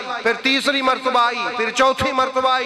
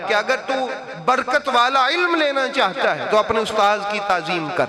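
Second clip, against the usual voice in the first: ordinary talk, nothing marked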